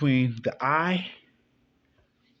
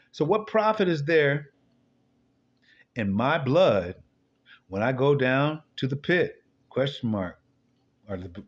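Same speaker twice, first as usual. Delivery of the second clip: slow, solemn psalm reading, slightly raised; scripture reading; 3 semitones higher, slower than usual. Acted. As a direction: read the psalm like a preacher. Reads psalm aloud.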